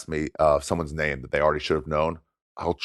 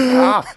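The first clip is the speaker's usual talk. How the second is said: mock disgusted shriek; 6 dB louder than usual. Clerk recoiling in story.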